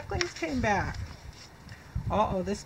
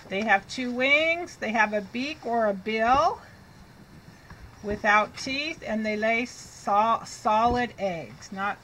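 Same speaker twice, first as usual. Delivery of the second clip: normal talking voice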